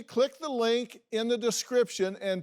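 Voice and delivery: outro call to action. Acted. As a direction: pitch it up like a channel sign-off. Host's closing call to action.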